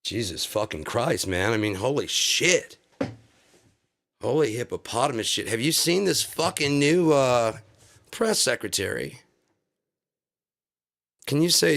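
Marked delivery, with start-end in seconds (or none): none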